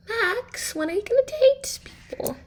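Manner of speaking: a pushy mother whining at her son; nagging mom. Acted. Mom begging Max to start dating, high nagging voice.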